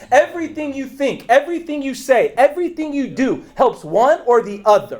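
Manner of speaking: rising preacher emphasis; lightly preached build. Acted. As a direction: hammer each everything like a pulpit. Preaching cadence.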